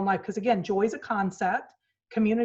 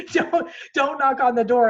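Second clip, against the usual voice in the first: put-on louder, higher, warning off the kids; mock stern warning; 5 semitones higher, 7 dB louder than usual. Mom warning her kids through the door, firm and loud. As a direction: insist firmly they stay away. Kids told not to knock.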